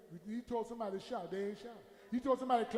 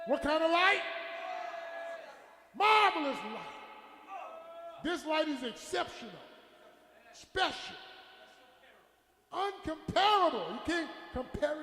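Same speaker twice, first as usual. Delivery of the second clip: slow, ringing exclamations with stretched key words; emphatic pulpit cry; full-force preaching; 6 semitones higher, 4 dB louder than usual. Real, not acted. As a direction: proclaim each describing word as its own burst.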